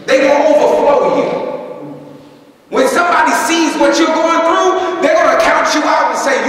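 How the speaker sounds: high, loud preaching shout; preaching at full force. Real, not acted. Pastor rousing the congregation, full-force preaching. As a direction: belt it to the back pews.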